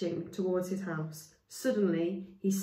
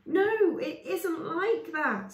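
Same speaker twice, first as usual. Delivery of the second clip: desperate protest; 11 semitones higher, 5 dB louder, much slower than usual. Acted. Chaya protesting, unheard.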